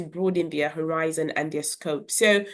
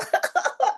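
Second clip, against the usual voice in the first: giggle. Retold or imitated. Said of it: "children giggling".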